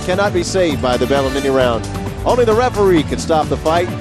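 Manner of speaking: usual speaking voice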